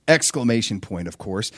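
ordinary delivery

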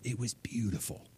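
hushed gentle approval; tender praise. Retold or imitated. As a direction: murmur it like a proud father. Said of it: "he's like".

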